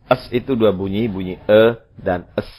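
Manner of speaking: usual speaking voice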